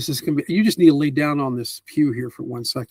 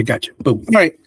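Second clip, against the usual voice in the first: ordinary talk, nothing marked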